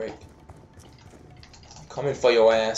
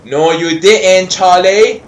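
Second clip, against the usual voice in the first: louder, higher, drawn-out disbelief; raised scolding; 7 semitones higher, 12 dB louder, slower than usual. Real, not acted. Gamer tells off Charlie, rising loud scolding. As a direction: build it upward to a loud name.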